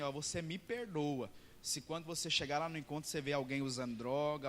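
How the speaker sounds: ordinary talk, nothing marked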